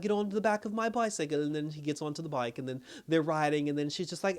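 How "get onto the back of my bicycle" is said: higher-pitched voice for a retold woman; lightly voiced woman urging him along; imitated woman. Woman tells him to get on her bicycle, lightly imitated.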